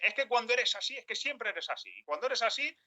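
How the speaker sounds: high, blaming reproach; reproachful. Retold or imitated. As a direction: accuse the other of always being like that. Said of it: "No es lo mismo decirte".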